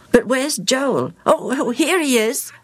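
put-on child voice. A narrator's reading. Child voice in story.

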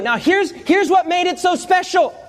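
normal talking voice

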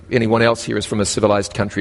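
ordinary talk

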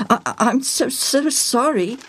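high, stammering apology; spluttering. A narrator's reading. Parrot's owner apologizing to the family, spluttering. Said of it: "Joel spluttered".

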